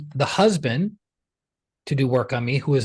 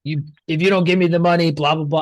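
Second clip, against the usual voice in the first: mock demanding; 2 semitones higher, faster than usual. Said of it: "and I wasn't like".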